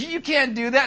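wife can't believe he pulled the battery; alarmed protest. Retold or imitated. Wife scolding husband over the smoke detector, alarmed. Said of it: "She says".

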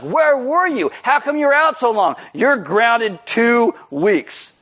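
high, slow imitated strict mother; scolding mom. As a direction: lay down the punishment word by word. Mom voiced scolding son.

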